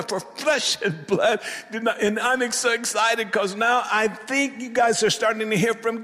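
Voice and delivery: high, loud, fired-up preacher; excited preaching. Acted. Preaches excitedly at raised pitch.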